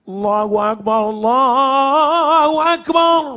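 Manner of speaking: booming, drawn-out shout of Allahu Akbar; loud dhikr. Shown as a demonstration. Preacher showing the crowd dhikr said loud, booming shout. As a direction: call it out so it shakes the hall.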